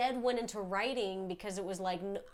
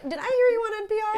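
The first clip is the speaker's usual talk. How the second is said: high, fast impressed question; 12 semitones higher, 11 dB louder, faster than usual. Retold or imitated. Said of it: "And they'll be like".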